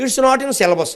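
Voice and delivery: "It's not in syllabus" pitched high in a mocking student voice; mock student reply. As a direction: toss it off like a student dodging the question. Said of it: "అని వాడు చెప్పరు".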